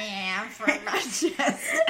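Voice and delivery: slow, laughing mock Mancunian; nasal Manchester impression breaking into laughter; nasal Manchester accent. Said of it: "I think it's really nasal".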